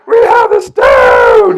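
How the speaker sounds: screaming high and loud, slowed; mock kid yelling in triumph; triumphant shout. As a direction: crow it out like a wild kid. Boys seizing control, shouting.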